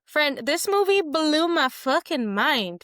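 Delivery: high, slow, emphatic rave; real awestruck enthusiasm; excited gushing. Real, not acted. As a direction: stretch it out high, truly blown away. Gushing about the movie to friend.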